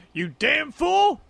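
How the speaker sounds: high, slow yelling insult; furious shout. Acted. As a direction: bellow it like a movie villain. Shouted movie quote.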